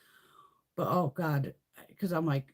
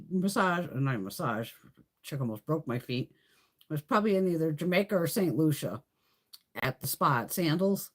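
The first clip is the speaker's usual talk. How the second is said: ordinary talk, nothing marked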